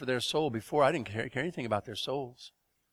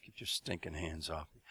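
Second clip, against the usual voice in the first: low, soft, menacing growl; low warning; 7 semitones lower, 11 dB quieter than usual. Retold or imitated. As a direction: say it quietly through the teeth. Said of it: "jerked his arm away and said".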